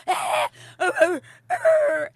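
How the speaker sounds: high, loud comic wails; mock wailing. Wife mid-meltdown before husband stops her, mock wailing. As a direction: wail wordlessly like a tantrum. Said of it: "I'm just like".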